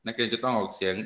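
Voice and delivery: ordinary talk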